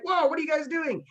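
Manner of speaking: a guardian surprised by attackers; startled monster. Acted. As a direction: sound caught off guard.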